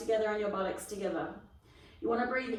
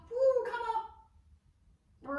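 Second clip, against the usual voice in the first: effortful woo; 12 semitones higher, 3 dB louder, much slower than usual. Real, not acted. Straining up in exercise.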